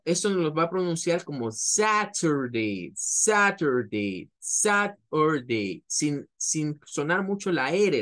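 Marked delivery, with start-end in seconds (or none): 1.5-5.8 a hint of British Saturday, crisp T. Shown as a demonstration. Teacher imitating a Briton saying Saturday. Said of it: "Un británico".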